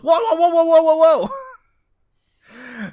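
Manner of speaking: very high, rapid panicked cries; mock panic. Imitating a man almost hit by a car, panicked.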